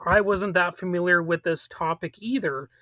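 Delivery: ordinary talk, nothing marked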